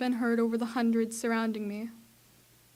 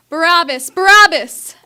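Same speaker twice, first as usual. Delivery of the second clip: full-force shout, higher and much louder; crowd shouting; 5 semitones higher, 18 dB louder than usual. Acted. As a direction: shout it like a mob demanding a prisoner. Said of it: "Everybody was shouting his name".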